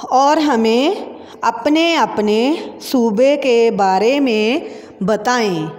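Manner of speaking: slow and measured; slow classroom dictation; slow dictation reading. Shown as a demonstration.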